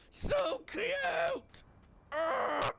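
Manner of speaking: very high squealed cooing; gushing squeal. Real, not acted. Player gushing over a new pup in the game, gushing squeal. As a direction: squeal as if overcome by cuteness.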